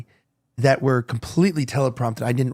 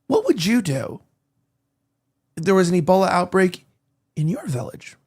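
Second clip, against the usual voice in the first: long pauses after "What would you do" and before "in your village"; leading question, paused; 3 semitones higher than usual. Shown as a demonstration. Host showing how to make viewers think, paused leading question. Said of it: "You ask them the questions".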